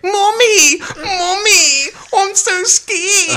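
put-on high, loud whining scared child; hammed-up little kid wailing for mum, drawn out; Samuel. Co-host imitating the horror film's scared kid, high mock wail. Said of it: "And then we get".

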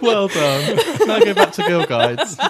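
laughing. Real, not acted. Teasing the guest, laughing.

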